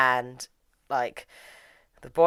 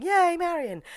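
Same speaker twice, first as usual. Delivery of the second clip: mock crowd cheer; slower than usual. Everyone cheers Marion on, imitated as a high mock shout. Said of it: "overheard everyone shouting".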